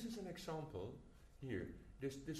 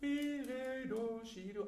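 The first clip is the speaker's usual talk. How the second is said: louder, higher sung note names; forte note names; 8 semitones higher, 11 dB louder, much slower than usual. Shown as a demonstration. Conductor singing the forte notes to the players. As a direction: sing the names out strongly. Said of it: "It's forte".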